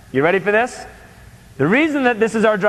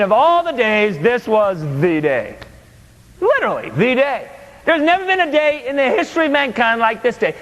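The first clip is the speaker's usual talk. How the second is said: story narration; 4 semitones higher than usual. A narrator's reading. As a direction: savor each phrase as the tale begins. Starts reading a story aloud.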